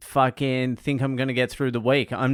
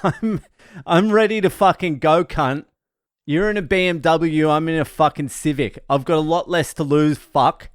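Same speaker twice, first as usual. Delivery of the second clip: higher and louder, squaring up for a fight; retold angry challenge; 4 semitones higher, 5 dB louder than usual. To the BMW driver beside him, a raised angry taunt.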